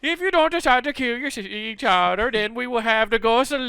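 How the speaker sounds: high, hammed-up European accent; cartoon European accent that misses Italian; botched Italian accent. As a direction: pronounce threats in a bad fake Italian accent. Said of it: "had no Italian accent there".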